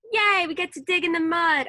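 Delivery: put-on high, loud child's cheer; loud, high excited yell; excited shout. A narrator's reading. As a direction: shout it out with glee. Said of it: "I shouted".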